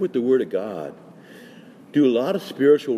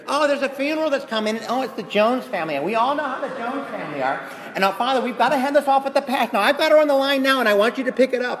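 much higher, flustered secretary, speeding up; fussy parish secretary; 11 semitones higher, faster than usual. Retold or imitated. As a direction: play the frantic secretary with the Jones funeral. Secretary voiced rushing in.

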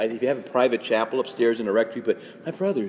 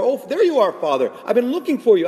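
much higher, louder eager greeting; eager parishioner; 10 semitones higher, 4 dB louder than usual. Retold or imitated. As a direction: pounce on the priest at breakfast. Parishioner voiced catching priest.

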